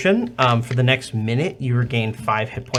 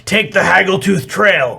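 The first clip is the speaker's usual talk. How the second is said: troll voice; 6 semitones higher, 5 dB louder than usual. Acted. Troll answers question.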